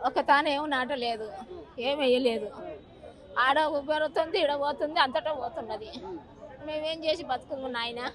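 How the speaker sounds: low, very soft grieving voice; real, subdued grief; tearful. Real, not acted. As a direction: sink into quiet despair, close to tears. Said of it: "ఎందుకు ఏడుస్తున్నావు మరి".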